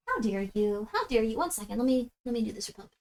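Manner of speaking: quiet offhand murmur; hushed aside. Real, not acted. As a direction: drop to a hush.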